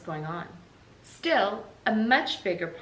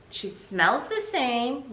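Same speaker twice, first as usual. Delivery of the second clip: put-on slow sleepy child; 6 semitones higher, 4 dB louder, much slower than usual. Sleepy little sister.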